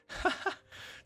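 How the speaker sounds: short, high chuckle; amused laugh. Real, not acted. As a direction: let out a quick high laugh. Amused by the story's line.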